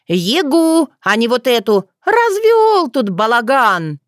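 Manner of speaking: high-pitched indignation; flaring up. A narrator's reading. Dunya demanding the real yaga, high and indignant. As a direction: scold with drawn-out outrage. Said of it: "взвилась Дуня".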